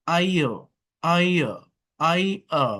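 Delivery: ordinary delivery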